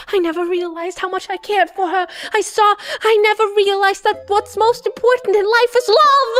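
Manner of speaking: rising emotional confession. Acted. Sasuke confessing to Naruto, voice swelling. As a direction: swell with feeling into the last word.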